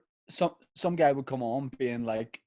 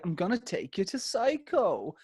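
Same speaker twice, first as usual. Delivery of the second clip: much higher impression of an eager manager; Louis Walsh; 8 semitones higher than usual. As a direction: announce it in a high put-on voice. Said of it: "so Louis's like".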